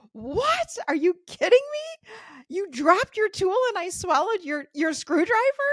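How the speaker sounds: high, loud disbelieving cry; high, loud shocked protest to the dentist; shocked outcry. Retold or imitated. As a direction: relive the moment you hear the tool went down your throat. Reliving shock of swallowing tool.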